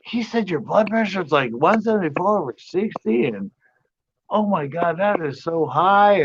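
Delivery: high, mock-alarmed retelling; retold manager fussing over the number. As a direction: play up her panic over the reading. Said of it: "and she's like".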